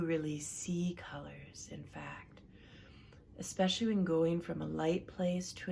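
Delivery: calm relaxation coach leading a breathing exercise; guided relaxation. Acted. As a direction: guide them gently through breathing, unhurried. Leads a guided relaxation session.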